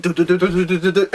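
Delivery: quick imitated shuffling footsteps; shuffle noise. Vlogger imitating Saitama shuffling on the floor, a playful mouth sound.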